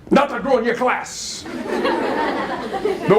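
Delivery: a mock-stern grandma who can't hold the laugh. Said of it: "put her hands on her hips and said".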